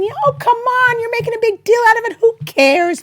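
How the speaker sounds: high, loud scoffing voice; dismissive scoffing. Acted. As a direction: brush it off like it's nothing. Mock dismissive voice in preview.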